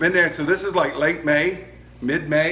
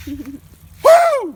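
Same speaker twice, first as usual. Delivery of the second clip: high, loud gleeful whoop; excited whoop; 11 dB louder than usual. Real, not acted. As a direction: cheer at the fire. Cheering at the flame.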